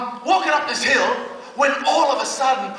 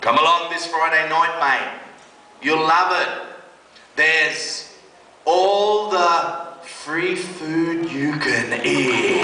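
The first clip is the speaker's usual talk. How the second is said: slow stretched "all the free food"; preacher landing the youth worker's offer of free food; punchline delivery; 4 semitones lower, slower than usual. Retold or imitated. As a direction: savour the punchline. The key quote, slowed.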